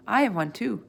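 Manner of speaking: plain reading voice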